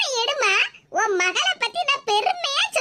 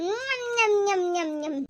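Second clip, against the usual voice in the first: low, soft nom-nom chewing sounds; munch happily through a mouthful; munching noises; 4 semitones lower, 6 dB quieter, much slower than usual. Acted. Daughter-in-law finishing off the lentil vadai, munching noises.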